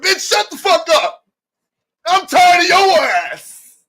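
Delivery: high, loud, slow screaming fit; furious blowup. Acted. Passive Libra finally yelling at partner, furious shout. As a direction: scream it like you finally snapped.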